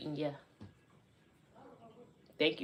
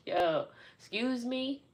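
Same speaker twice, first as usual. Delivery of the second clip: high, drawn-out challenge; slow, pitched-up incredulous question; mock affront; 7 semitones higher, slower than usual. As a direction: playfully act offended at a stranger. Acting out her affronted retort.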